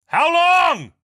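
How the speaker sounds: very high, loud yell; explosive demand. Acted. Police captain demanding an answer from his ally, explosive shout. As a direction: explode on the repeated question.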